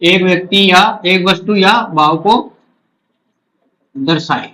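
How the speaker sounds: slow chunks with long pauses; dictating while writing. Real, not acted. Teacher writes out the rule for students, slow dictation. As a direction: dictate phrase by phrase, waiting after each.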